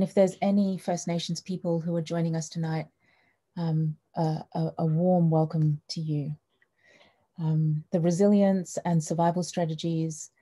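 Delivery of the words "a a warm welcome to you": ordinary delivery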